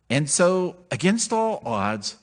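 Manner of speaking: ordinary delivery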